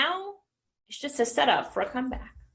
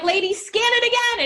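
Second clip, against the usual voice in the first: much higher, pushy plea to scan again; high exasperated demand; 11 semitones higher, 4 dB louder than usual. Retold or imitated. Speaker to cashier, high exasperated demand. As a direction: insist in a raised mock-frantic voice. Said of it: "I'm like".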